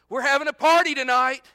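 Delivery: loud, high, jubilant call; excited announcement. Acted. Parent phoning round with news, loud and excited. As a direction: shout the party news. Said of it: "and you go".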